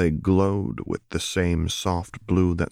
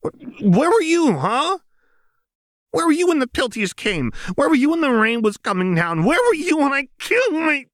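high, loud, screaming accusations; furious snarl; 5 dB louder than usual. A narrator's reading. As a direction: rage building to a shout. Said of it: "suddenly furious, and snarled out".

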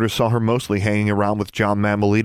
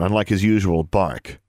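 plain reading voice

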